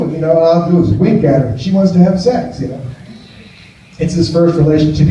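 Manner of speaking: ordinary delivery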